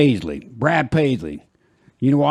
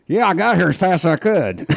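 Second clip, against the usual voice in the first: cheeky driver reply; 6 semitones higher than usual. Retold or imitated. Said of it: "and then you say".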